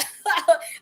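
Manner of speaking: a short high burst of disbelieving laughter; incredulous laugh. Real, not acted. Laughing at being called not Christ-like, incredulous laugh. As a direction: laugh off the accusation.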